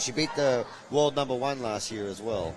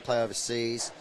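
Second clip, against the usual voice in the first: normal talking voice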